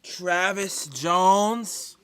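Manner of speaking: slow, loud, stretched-out cry of despair; anguished drawn-out yell. Real, not acted. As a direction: drag out each word in a loud groan. Watching the pick go elsewhere.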